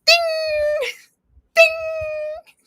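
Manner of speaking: high, ringing "ding", drawn out; loud high bell imitation; imitated ding. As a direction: mimic a loud alert chime. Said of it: "the dings are, uh, Glenda's thoughts".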